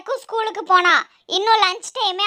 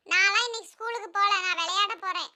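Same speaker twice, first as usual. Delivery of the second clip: put-on soft, slightly thin child's voice; young son Jakku; 7 dB quieter than usual. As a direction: play a small boy refusing his mother. Son refusing school in story. (சின்னப் பையனின் மெல்லிய குரல்)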